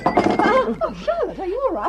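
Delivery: high, loud startled cry of alarm. Acted. Hilary crying out to Charlotte at the broken glass, high alarm. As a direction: gasp it out as the glass shatters. Glass breaks, friend cries out.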